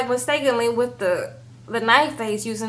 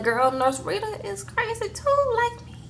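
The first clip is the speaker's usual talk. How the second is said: high mock quote; 10 semitones higher, 4 dB quieter than usual. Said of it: "Montana shook like".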